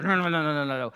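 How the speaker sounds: low run of mock refusals; dismissive refusal. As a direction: imitate a lecturer waving off a wrong answer. Lecturer rejecting each student answer.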